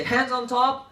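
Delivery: lifted cueing chant; attention-getter call. Shown as a demonstration. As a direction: call it out to the whole class. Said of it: "So I say".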